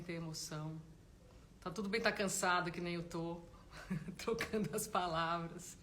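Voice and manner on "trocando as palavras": slow, through a laugh; chuckling self-mockery; amused laughing. Real, not acted. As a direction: laugh at your own slip while talking. Laughs at own tired word slips.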